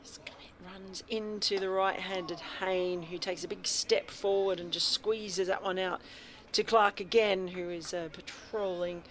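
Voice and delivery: lively ball-by-ball radio call; radio cricket commentator calling the over; cricket commentary. Acted. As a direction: call the play as it happens.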